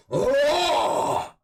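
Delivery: very high, loud sudden shout; excited yell. Real, not acted. Streamer lets out a loud whoop of delight.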